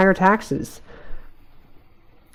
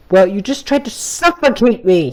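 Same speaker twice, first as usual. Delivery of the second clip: mild yell; 5 semitones higher than usual. Acted. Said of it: "he yelled".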